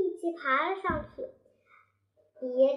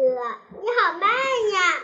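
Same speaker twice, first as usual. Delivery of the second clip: teasing; 3 semitones higher, 7 dB louder, slower than usual. Acted. Daniu teasing from treetop.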